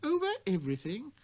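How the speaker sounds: high, astonished question; can't believe it; little prince, amazed at the king's claim; prince's surprise. Acted.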